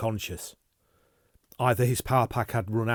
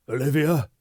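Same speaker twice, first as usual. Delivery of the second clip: stunned question; 5 semitones higher, 7 dB louder than usual. Acted. Stunned recognition.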